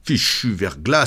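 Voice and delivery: plain reading voice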